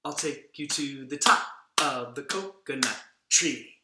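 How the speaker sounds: slow, loud, each word tapped to the beat; sing-song it out slowly to show the gesture; slow demo line. Shown as a demonstration. Puppet demonstrates the coconut tree line, slow and rhythmic. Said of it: "you're gonna do this with your hands".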